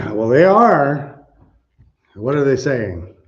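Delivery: ordinary talk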